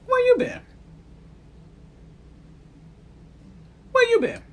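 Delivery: very high, loud playful call; excited greeting. Real, not acted. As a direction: call out like a missed friend. Greeting a returning viewer.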